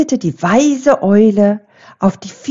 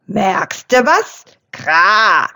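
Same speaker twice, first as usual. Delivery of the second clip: croaking raven; much slower than usual. A narrator's reading. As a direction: croak it out, glad and raspy like a raven. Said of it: "unterbrach Rabe Krax das Eichhörnchen".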